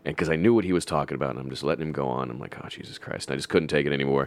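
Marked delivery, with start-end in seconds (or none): 2.6-3.3 whispered exasperated groan; muttered under breath. Retold or imitated. Friend mutters over a coworker's endless story, whispered. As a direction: breathe it out, fed up.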